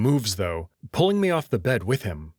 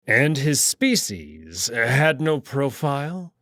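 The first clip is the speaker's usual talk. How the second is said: cold and slow interrogation; slow stern question; 3 semitones higher, slower than usual. Acted. Kato coldly interrogating Felix about the alien. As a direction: press the doctor, every word weighed.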